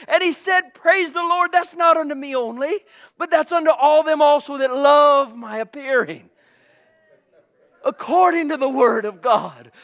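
high, loud revival sermon; full-force preaching. Real, not acted.

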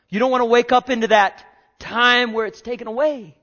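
full-force preaching. Real, not acted.

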